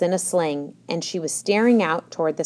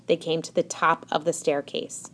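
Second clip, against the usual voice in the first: ordinary delivery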